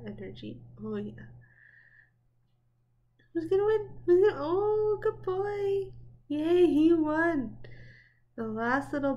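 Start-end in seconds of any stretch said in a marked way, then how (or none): 3.3-7.6 high, loud cheering for the dog; root for the dog out loud; excited cheering. Real, not acted. Cheering on the racing dog in the clip, high and loud.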